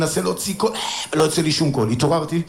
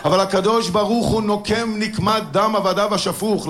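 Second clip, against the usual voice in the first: ordinary talk, nothing marked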